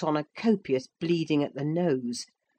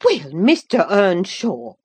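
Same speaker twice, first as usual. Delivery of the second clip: loud, drawn-out, higher housewife's rebuke; scolding cry; 4 semitones higher, 7 dB louder than usual. A narrator's reading. Housewife turning on the young man, scolding cry. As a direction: cry it out indignantly, slowly. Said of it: "she cried".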